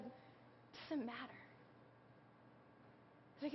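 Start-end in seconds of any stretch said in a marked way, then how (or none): 0.0-1.4 soft retold forgiveness. Husband forgiving, retold tenderly.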